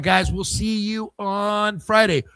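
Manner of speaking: ordinary delivery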